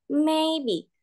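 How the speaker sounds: MAYBE with MAY held high and level; first tone. Shown as a demonstration. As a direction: hold the first part of maybe on one high note. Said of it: "nada satu berarti kita pakai". (maybe dengan nada satu, tinggi dan rata)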